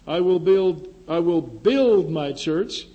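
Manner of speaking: "build" hit hard and loud; determined emphasis. Shown as a demonstration. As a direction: say "I will build" like nothing can stop it. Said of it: "meaning nothing's gonna stop me".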